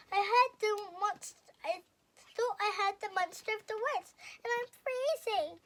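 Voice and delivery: high, loud and frightened; a tiny mouse shivering at the door; scared Mouse. A narrator's reading. Mouse begging Owl to come in, high and scared.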